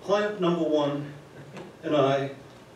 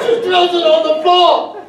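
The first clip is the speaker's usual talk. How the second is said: shouting through laughter; laughing hard while telling; loud laughing; 14 semitones higher, 14 dB louder than usual. Real, not acted.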